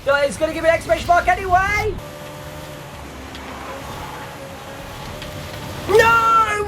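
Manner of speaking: real exasperation; frustrated cry. Real, not acted. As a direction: despair at the ruined lap. Realises lap is ruined.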